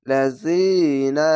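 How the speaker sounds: লাযিনা drawn out in slow recitation; one alif stretch. Shown as a demonstration. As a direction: hold the vowel in লাযিনা one count. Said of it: "এক আলিফ টেনে পড়বেন".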